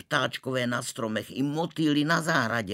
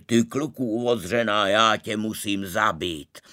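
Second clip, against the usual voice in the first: louder, menacing threat; threatening Maharaja; 5 dB louder than usual. Acted. Maharaja threatening the former suitor, loud. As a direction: spit the threat at him.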